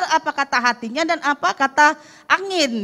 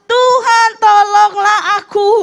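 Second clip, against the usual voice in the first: higher, louder, slow cry for rescue; crying out for help; 7 semitones higher, 7 dB louder, slower than usual. Acted. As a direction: call out to the Lord like a drowning man. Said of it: "lalu berteriak". (teriakan minta tolong yang keras dan lambat)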